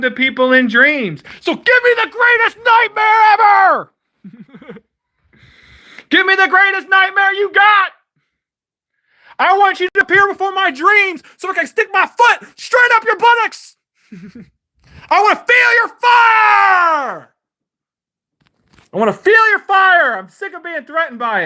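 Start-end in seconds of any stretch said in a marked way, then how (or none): 1.4-3.9 much higher, loud taunting yell; mocking challenge yelled high; defiant shout. Real, not acted. Daring Allah to send nightmare. 6.1-13.8 defiant yell. Real, not acted. Daring Allah in dreams. 15.1-20.2 defiant shout. Real, not acted. Daring God with fire.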